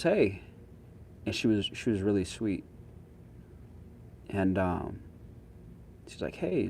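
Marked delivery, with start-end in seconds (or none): 0.0-0.4 imitated startled greeting, pitched high; a quick high "hey", as if caught off guard. Homeless lady greeting a stranger at her bush, mildly startled. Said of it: "she was clearly startled by me". 6.4-6.7 startled hey. Retold or imitated. Homeless lady surprised by a man walking up, mildly startled. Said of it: "she was clearly startled by me".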